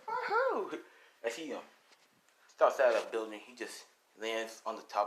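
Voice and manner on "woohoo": high, gleeful cheer; a hero whooping with joy; excited whoop. Acted. Dick cheering on the rooftop.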